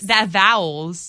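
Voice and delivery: "vowels" stretched out slowly; slow Southern-style "vowels"; drawled vowels. Shown as a demonstration. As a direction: draw out the accented vowel in "vowels". Demonstrating accented vowels together.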